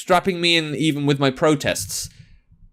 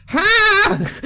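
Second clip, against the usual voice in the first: high pained shriek into a laugh; 13 semitones higher than usual. Acted. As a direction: shriek then laugh through pain. Reacting to bone stabbed.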